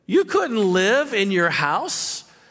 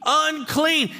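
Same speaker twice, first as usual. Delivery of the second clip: high, raised warning call; yelling unclean; 8 semitones higher, much slower than usual. Retold or imitated. Leper warning anyone close, a high raised call. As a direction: cry it out to anyone coming near. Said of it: "you had to yell out".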